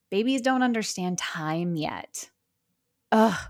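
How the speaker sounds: ordinary talk, nothing marked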